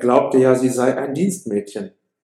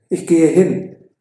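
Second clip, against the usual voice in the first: firm, high, louder resolve; determined; 5 semitones higher, 6 dB louder than usual. Acted. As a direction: announce it with a decision made. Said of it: "erklärt Romeo entschlossen". (Romeo zu Mercutio: entschlossen, höher und lauter)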